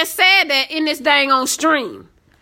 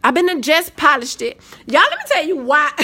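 normal talking voice

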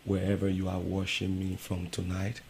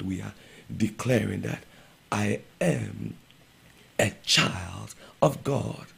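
preaching building; 2 semitones higher, 4 dB louder than usual. Acted. Pastor proclaiming believers are children of God. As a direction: build it up like a sermon.

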